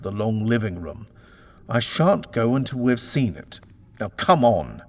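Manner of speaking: loud, commanding insistence; stern demand. Acted. Husband insists on seeing the hidden room, loud and commanding. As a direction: lay down the law, no arguing.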